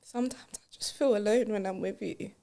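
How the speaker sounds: quiet, hesitant apology; hushed apology. Acted. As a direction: say it softly, ashamed.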